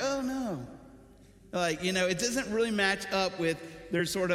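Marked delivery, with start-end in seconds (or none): 0.0-0.7 mock dismay. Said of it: "you're like".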